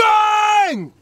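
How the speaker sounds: high shouted scream. Real, not acted. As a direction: let out a big yell. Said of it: "I ain't screamed in a long time".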